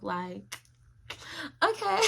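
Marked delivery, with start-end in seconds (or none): 1.6-2.0 high, giggly little squeak; bubbly high-voiced reply; cute and bubbly. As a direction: play it cute, pitched right up. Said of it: "being my cute, like, bubbly self".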